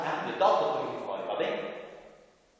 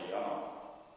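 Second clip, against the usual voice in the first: ordinary delivery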